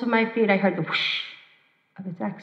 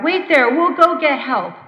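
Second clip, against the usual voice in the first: louder, higher urgent call; 5 semitones higher, 7 dB louder than usual. Retold or imitated. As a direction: shout reassurance before running off. Rescuers shouting to trapped driver.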